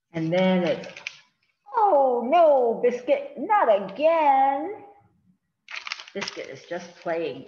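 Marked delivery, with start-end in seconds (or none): 1.8-4.8 put-on 1 little girl voice. Little girl scolding puppy.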